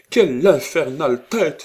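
high, loud exclamation; exasperated outcry. A narrator's reading. Old lady thwarted by the headstrong girl, exasperated outcry. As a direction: cry out in furious exasperation. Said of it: "s'écria la Desroches".

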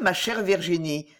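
ordinary delivery, nothing marked

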